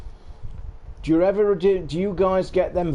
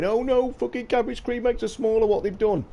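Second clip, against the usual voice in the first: high whiny mock outrage; whiny outraged public; 9 semitones higher than usual. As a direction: squeal it like an outraged fan. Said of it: "and they were going".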